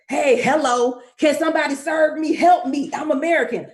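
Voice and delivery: loud, pushy tourist calling for service; clueless loud American tourist. Retold or imitated. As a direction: play the helpless American demanding help, loud. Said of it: "so I'm not in there as a".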